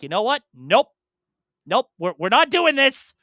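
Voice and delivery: hammed-up refusal, shutting the door on Hollywood; imitating Miyamoto refusing. Podcaster mimics Miyamoto turning down Hollywood, mock firm and loud. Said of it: "just making him go like".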